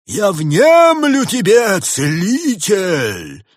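high, loud, long drawn-out proclamation; booming encouragement. A narrator's reading. Winter spirit urges the healer on, loud drawn-out encouragement. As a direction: stretch every word out like a grand spirit, loud and high. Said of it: "подбодрил дух зимы".

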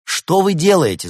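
high, loud challenge; shouted demand; indignant shout. A narrator's reading. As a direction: yell it at a kidnapper to stop them. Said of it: "закричал Улис".

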